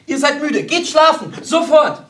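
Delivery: high, urgent, loud orders; anxious shouting. A narrator's reading. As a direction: order the children away in alarm. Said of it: "schrie Baronin von Butler ängstlich".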